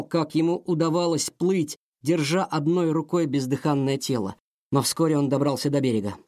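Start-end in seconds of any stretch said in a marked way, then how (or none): none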